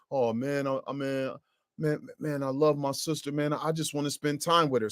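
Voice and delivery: mope softly, stumbling over the words; lovesick moping. Acted. Lovesick man confides in his sneaky friend, quiet moping.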